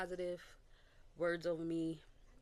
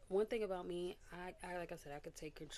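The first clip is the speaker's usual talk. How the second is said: ordinary talk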